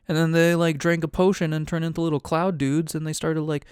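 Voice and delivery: usual speaking voice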